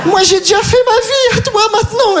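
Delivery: hammed-up crying mum; mock emotional mother. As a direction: voice her cracking as she lets go. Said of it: "Elle regarde, elle fait".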